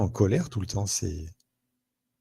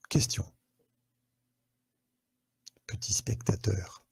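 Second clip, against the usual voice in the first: whisper; 5 dB quieter than usual. Acted. Host teasing viewers before question.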